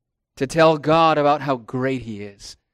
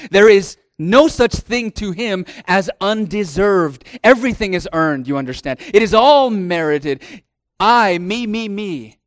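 higher, louder boastful mock voice; mocking arrogant man; 4 semitones higher, 5 dB louder than usual. Preacher mocking the worldly man's pride, higher and louder. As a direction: lampoon the self-important man.

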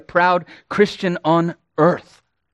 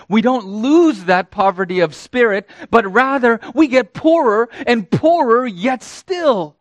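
raised, higher-pitched pulpit voice; impassioned preaching; 6 semitones higher than usual. Real, not acted. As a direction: build in waves of repeated phrases. Preacher builds to a climax.

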